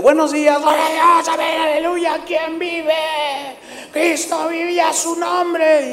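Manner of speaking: high, bright call-and-response greeting; excited church greeting. Retold or imitated. A guest preacher greeting the congregation, high and rousing. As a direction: fire up the congregation from the pulpit. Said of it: "y me presentan y yo digo".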